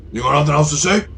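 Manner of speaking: high-pitched, taunting raider leader; needling road bandit voice; gang boss. Acted. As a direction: push it high, challenge him. Gang leader challenges Jackal.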